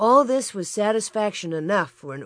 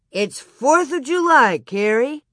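raised voice, lifted in pitch; calling through partition; 6 semitones higher, 6 dB louder than usual. Acted. Pa answering Carrie through the partition, calling out. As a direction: call it through to the next room. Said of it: "Through the partition, Pa answered".

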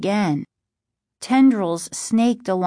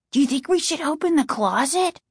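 higher, quick, mildly nervous question; timid; 8 semitones higher, faster than usual. A narrator's reading. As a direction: ask with a touch of timidity. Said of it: "Casey asked timidly".

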